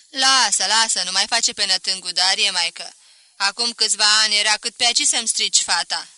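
very loud, knowing reproach; scolding mother-in-law. Acted. Mother-in-law calls out Darie for playing dumb, loud scolding. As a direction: brush off his act, loudly.

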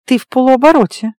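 high, tense warning. A narrator's reading. Woman warning her half-shifted lover, tense and high. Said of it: "напряжённо сообщила я".

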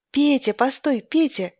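high, lightly called-out interruption; mild storybook sister's cry; sudden cry. A narrator's reading. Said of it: "крикнула вдруг сестра".

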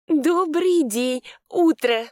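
high, slow, forced cheerful greeting. A narrator's reading. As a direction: greet them with a strained smile. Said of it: "натянуто улыбнулась".

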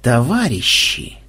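slow, drawn-out sinister address; ominous announcement. A narrator's reading. Ксенобайт rallying comrades against madam, ominous. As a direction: draw it out like a dark proclamation. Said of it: "зловеще возвестил Ксенобайт".

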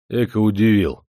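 lightly amused, unimpressed reply; smiling unconcern. A narrator's reading. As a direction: shrug it off with a smile. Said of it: "улыбнулся Демидов". (с усмешкой, невозмутимо)

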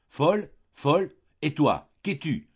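high, loud outraged echo, slowing on the jab; indignant retort. Acted. The countess hurls the insult back at Delphine, outraged. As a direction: fling the word back at her sister.

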